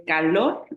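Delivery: calor slowly, with LOR the strongest; stress on final syllable. Shown as a demonstration. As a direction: say ca-LOR, leaning on the end. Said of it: "la que suena más fuerte es lor".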